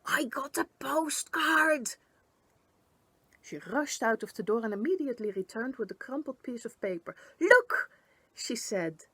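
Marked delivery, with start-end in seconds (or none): 0.1-2.0 high, slow, breathy-whispered burst of excitement; high, slow, excited shriek with no voice. A narrator's reading. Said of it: "she shrieked". 7.4-7.9 very high and loud; high, loud excited call. A narrator's reading. Gunk excited shows postcard.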